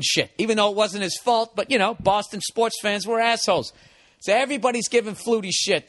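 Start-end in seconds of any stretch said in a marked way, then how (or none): none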